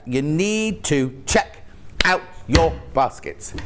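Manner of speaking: slow, every word spaced out; slow emphatic repeat. Real, not acted. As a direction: spell it out slowly for viewers. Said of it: "repeated slowly".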